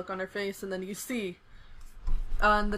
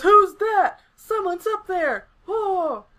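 high, loud startled cries; startled crowd; 10 semitones higher, 9 dB louder, much slower than usual. Acted. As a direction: call out like an alarmed onlooker. Crowd spots someone above.

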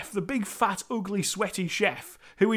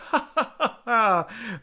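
mock laughing it off; 2 semitones higher, slower than usual. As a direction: laugh it off as if it's a joke. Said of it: "he just laughs it off".

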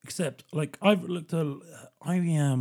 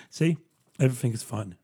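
ordinary delivery

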